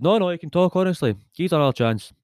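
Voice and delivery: joke-teller acting out a protest; pleading dog owner. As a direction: plead lightly for another chance. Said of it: "And the guy says".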